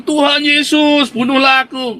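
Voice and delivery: high, loud, drawn-out cry of Tuhan Yesus; hammed-up dare to be struck down; mock desperate plea. Preacher mimicking a believer's angry dare. Preacher acts out a provoking prayer.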